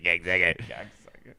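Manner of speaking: half speed, low and mocking; slow low drawn-out insult. Real, not acted. Friend repeating the insult, low and drawn out. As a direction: drag it out low and slow, savouring it.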